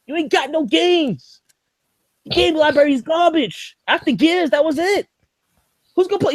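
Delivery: high, heated rant that keeps climbing; mock rant. Ranting retort to Meech.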